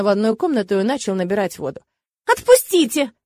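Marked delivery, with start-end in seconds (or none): 2.3-3.1 high, loud indignant demand; outraged, raised-pitch cry; ringing with indignation. Acted. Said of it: "звенящим от возмущения голосом".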